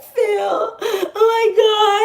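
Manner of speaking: high, slow sobbing whine; mock crying to a friend. Calling her friend Phil in a mock crying whine, high and slow. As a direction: drag it out like a fake sob. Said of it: "And I would call my friend Phil".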